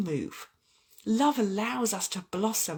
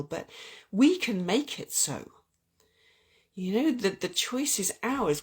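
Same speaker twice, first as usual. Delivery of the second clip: ordinary talk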